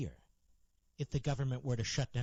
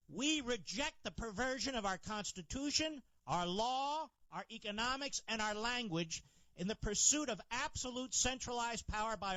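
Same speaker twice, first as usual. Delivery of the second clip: higher-pitched, emphatic rallying speech with deliberate pauses; impassioned political oratory; 8 semitones higher than usual. Real, not acted. Radio host rallying listeners against big government, impassioned oratory. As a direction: deliver a stump speech, phrase by phrase.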